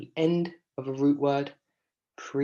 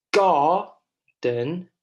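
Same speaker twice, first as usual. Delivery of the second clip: "garden" split, loud GAR, then a quieter den; stressed first syllable; 3 semitones higher, 6 dB louder than usual. Shown as a demonstration. Teacher demonstrates unstressed last syllable, stress on first. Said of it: "unstressed syllables are pronounced slightly quieter".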